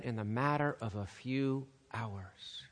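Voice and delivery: hushed, ominous whisper. Acted. Preacher warning the congregation of coming betrayal, whispered. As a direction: whisper it like a dark secret.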